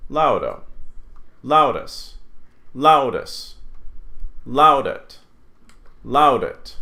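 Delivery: "Laudo, Laudus, Laudet" each hit on LAU; first-syllable stress. Shown as a demonstration. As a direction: punch the start of "Laudo, Laudus, Laudit", ending light. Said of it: "never on the last syllable".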